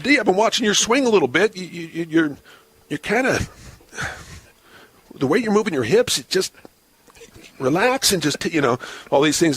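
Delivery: a hint of a fake instructor fussing over his hips; mock roving hitting coach. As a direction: play the phony expert sweet-talking his swing. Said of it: "And I said".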